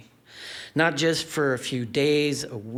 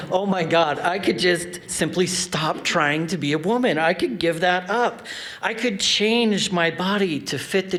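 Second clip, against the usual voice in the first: elated realization; 2 semitones higher than usual. Real, not acted. Storyteller reliving an epiphany at forty, elated. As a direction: let the epiphany burst out.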